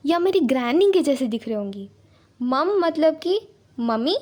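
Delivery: ordinary talk, nothing marked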